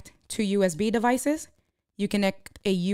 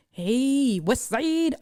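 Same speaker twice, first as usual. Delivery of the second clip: long drawn-out, higher hail; playful drawn-out shout-out; calling out greeting; 5 semitones higher, much slower than usual. Real, not acted. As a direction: stretch the greeting out, sliding up. Hailing viewers in the chat.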